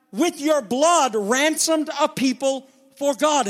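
high, driving pulpit delivery; impassioned preaching. Real, not acted. Preacher pressing the slain Lamb on congregation, impassioned preaching. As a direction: preach it with rising fervor, pitch way up.

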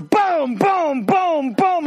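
high, punchy cartoon booms; comic crash noises; boom sound effects. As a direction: bang out each boom like a slam. Elephant slams lion sound effect.

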